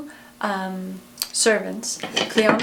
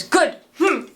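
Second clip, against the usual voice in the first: angry grunt; 6 semitones higher, 3 dB louder than usual. A narrator's reading. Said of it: "And he storms off".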